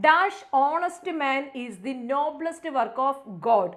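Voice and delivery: very slow, deliberate reading; slow read-aloud. Shown as a demonstration. Teacher reading the question slowly to students. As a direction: read each word out slowly. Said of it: "reads question slowly".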